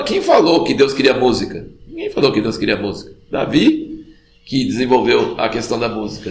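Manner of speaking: usual speaking voice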